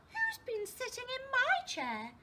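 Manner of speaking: high-pitched medium-sized bear voice; a mummy bear's high, put-out question; Mother Bear. Acted. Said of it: "in a medium-sized voice".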